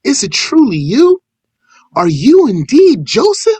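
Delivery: high, incredulous questioning; seeking confirmation. Retold or imitated. Brothers asking whether this is Joseph, disbelieving. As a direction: ask it high, leaning on the key words. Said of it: "In seeking confirmation".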